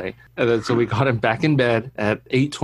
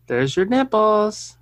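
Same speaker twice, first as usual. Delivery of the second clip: put-on child's voice; 8 semitones higher, slower than usual. Son quoted, childlike high voice.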